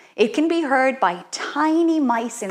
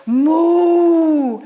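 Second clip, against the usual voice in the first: long high loud moo; biggest moo; 6 semitones higher, 6 dB louder than usual. Shown as a demonstration. Teacher asking kids for the biggest moo, loud moo. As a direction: give the biggest moo you can. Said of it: "Who's got the biggest moo?".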